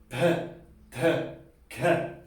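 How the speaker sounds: P, T, K con soplo de aire fuerte; aspirated P T K. Shown as a demonstration. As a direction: empuja aire en cada consonante. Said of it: "tiene que echar aire para afuera". (P, T, K aspiradas)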